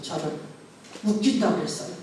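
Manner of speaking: ordinary talk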